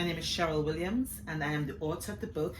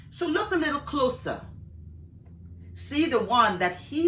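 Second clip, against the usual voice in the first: poem recitation; 3 dB louder than usual. Acted. Author reading her poem Optimism to an audience, measured poetry recitation. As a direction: recite with lifted openings and falling line ends.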